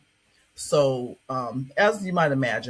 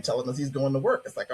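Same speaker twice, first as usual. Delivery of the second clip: ordinary talk, nothing marked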